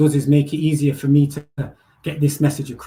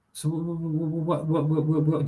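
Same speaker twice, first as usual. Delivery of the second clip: mock stutter; 5 dB quieter than usual. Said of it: "do I stutter".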